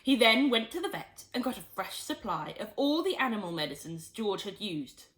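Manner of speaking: usual speaking voice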